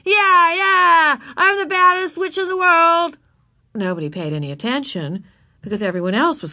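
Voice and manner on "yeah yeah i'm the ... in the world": high, loud whooping yell; boastful playground witch shriek; screaming child witch. A narrator's reading. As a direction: holler it out like a wild little witch. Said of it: "Ramona ran screaming into the playground".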